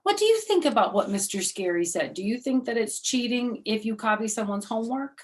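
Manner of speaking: plain reading voice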